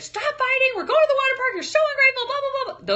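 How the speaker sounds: very high shrill scolding mom; frustrated yelling. Retold or imitated. Mom scolding arguing kids before the water park. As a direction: snap at the bickering kids. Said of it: "Those are things I was thinking".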